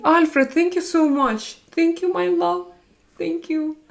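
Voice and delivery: high-pitched, affectionate gratitude; high, tender, touched thank-you; gushing thanks. Real, not acted.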